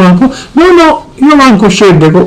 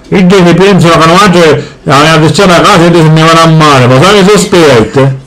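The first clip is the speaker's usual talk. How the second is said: low, heavy father voice; gruff Sicilian dad; 9 semitones lower, faster than usual. Acted. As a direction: boom it out like a grumpy papà. Father again.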